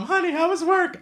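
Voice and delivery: high, sing-out spouse greeting; cheery spouse. Acted. Spouse greets the cop as he comes home, high and cheery. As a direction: put on a high-pitched homecoming greeting.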